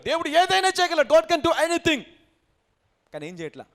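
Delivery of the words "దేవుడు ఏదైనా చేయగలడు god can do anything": high, forceful proclamation of faith; loud declaration. Retold or imitated. Believer declaring God's power to others, loudly emphatic. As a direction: proclaim it louder than the preacher. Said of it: "నాకంటే ఎక్కువ గట్టిగా చెప్తావు".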